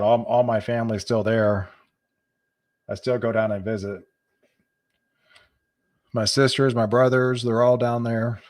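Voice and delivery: usual speaking voice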